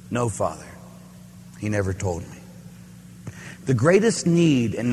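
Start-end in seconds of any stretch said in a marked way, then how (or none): 0.1-2.4 quiet. Retold or imitated. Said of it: "And he says".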